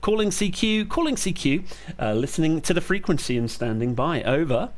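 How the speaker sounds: steady, clipped radio call sign readout; radio call procedure. Real, not acted. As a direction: call it out like a ham operator. Calling CQ on ham radio.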